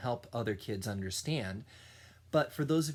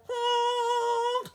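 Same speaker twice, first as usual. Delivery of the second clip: honk; 6 dB louder than usual. Acted. Animals making music.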